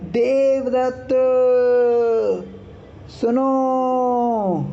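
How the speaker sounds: Devdutt stretched long and slow, pitched high; drawn-out call. Shown as a demonstration. Teacher calling out to Devadatta, vowels drawn out. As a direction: call out Devdutt, सुनो dragging every vowel. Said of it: "आप किसी को आवाज़ लग रही है".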